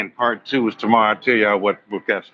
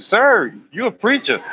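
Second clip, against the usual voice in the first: Carmen's voice; 10 semitones higher than usual. Retold or imitated.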